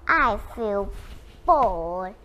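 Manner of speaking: low, drawn-out bored groan of words; acted boredom, sinking in pitch. A narrator's reading. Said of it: "really bored".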